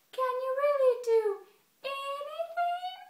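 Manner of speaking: very high, slow, drawn-out squirrel voice; little squirrel. Acted. Little brown squirrel challenging Bear again, high-pitched and slow. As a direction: pipe it slowly as a tiny doubter. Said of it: "Little brown squirrel says again".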